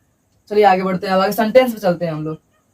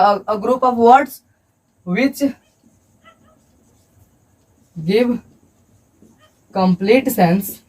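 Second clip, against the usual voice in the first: slow dictation; 2 semitones lower, much slower than usual. Shown as a demonstration. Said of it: "मैं बोल रहा हूँ".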